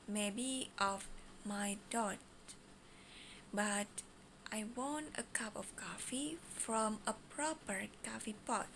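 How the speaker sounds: slow, broken into chunks; slow mode. Shown as a demonstration. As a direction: say it slowly, piece by piece. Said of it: "Slow mode".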